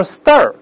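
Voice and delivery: stir, r-coloured from the start of the vowel; wrong all-r vowel. Shown as a demonstration. Teacher warns students off a mistake, stir with curled vowel. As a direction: say star wrongly as stir, no ah first. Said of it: "也不能说stir".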